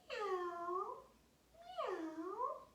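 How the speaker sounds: high, drawn-out kitty meows; cat meow. Pretend. Kids' yoga teacher meows along in cat pose, high cat meow. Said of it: "we can meow together".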